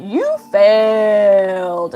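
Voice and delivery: imitating the examiner, slow and loud, FAILED stretched long; drawn-out pronouncement. Examiner telling the woman before her she failed, drawn-out loud pronouncement. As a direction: hold out "failed" like a loud verdict. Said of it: "walked up to her in her car and went".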